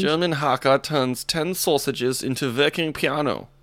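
put-on hammy German accent, deliberately enunciated; mock German newsreader accent. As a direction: read it like a cartoon German. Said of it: "but with an accent".

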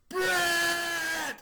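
high, loud drawn-out cry; imitated child's long shout; kid calling out. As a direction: call to your hero like an excited kid. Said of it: "And then the kid goes".

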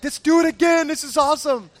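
high, loud daredevil enthusiasm; giddy excitement. Retold or imitated. Husband after the near miss, giddy excitement. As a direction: egg them on, thrilled and high-pitched. Thrill seeker begs for another round.

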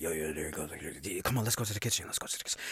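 low, quick, breathy whisper fading out; hushed whisper. Retold or imitated. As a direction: sneak off without a sound. Sneaking to the kitchen.

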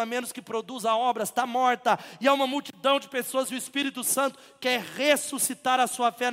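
loud, rising sermon cry; fiery preaching. Acted. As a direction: preach it out with full force. Preaching at full force. (pregação inflamada)

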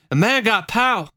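high-pitched congregant exclamation; senior citizen testifying. Acted. Senior citizen testifies at the meeting, high proclaiming voice. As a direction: proclaim it like a church lady. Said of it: "and she used to say".

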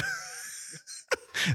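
high piercing killer cackle; evil shriek. Pretend. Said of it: "what a killer tomato sounds like".

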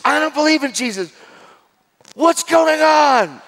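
high, loud mock shriek; imitating angry woman. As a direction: shriek it like an indignant woman being quoted. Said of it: "And she's so angry".

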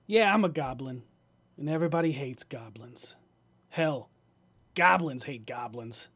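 loud, rasping goblin ranting at the crowd; grating nasal goblin voice. Acted. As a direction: sneer it out like a nasty goblin. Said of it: "grating, nasally goblin voice".

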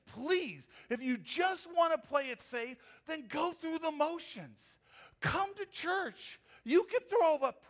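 high-pitched ironic preaching; mock advice. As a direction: hold the pitch up, mocking going through the motions.

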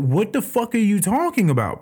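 can't believe the question, voice jumping up; baffled pilot. Retold or imitated. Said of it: "He's like".

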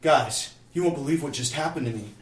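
imitating someone bursting with amazing news; excited announcement. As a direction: gush to your friends like a thrilled convert. Retold new believer telling his friends.

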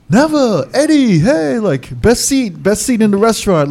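fawning club host. Retold or imitated. Staff welcoming the famous DJs, eager fawning host. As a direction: imitate staff rolling out the red carpet.

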